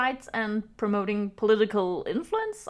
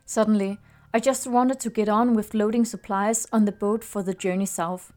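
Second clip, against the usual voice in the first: story narration. A narrator's reading. Reads the story over music.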